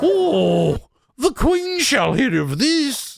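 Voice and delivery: high, slow, prissy English lady voice; hammed-up outraged English lady, drawn out; shitty English accent. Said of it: "excuse for me to do shitty English accents".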